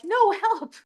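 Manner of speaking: high, urgent mock plea; desperate plea. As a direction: insist it's an emergency, pitched high. Correcting the waving neighbour.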